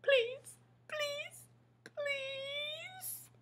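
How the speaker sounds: high, fading whimpered pleas; frightened monster pleading with the reader; begging Grover. Acted.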